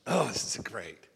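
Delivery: normal talking voice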